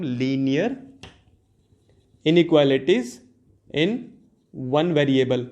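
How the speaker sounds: word by word with long pauses; slow dictation. Shown as a demonstration. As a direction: dictate the term as it is written, pausing after each part. Teacher dictates the term being written. (रुक-रुककर लिखवाते हुए बोलना)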